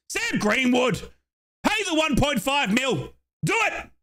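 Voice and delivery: high-pitched, excited plea to sign him; excited urging. Real, not acted. Fan begging the club to buy Greenwood, excited.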